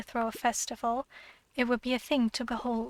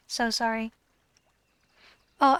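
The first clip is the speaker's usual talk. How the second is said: ordinary delivery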